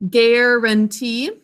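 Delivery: "Guarantee" stretched slowly syllable by syllable; teacher sounding out a word for kids, slow. Shown as a demonstration. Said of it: "Let's sound that out together".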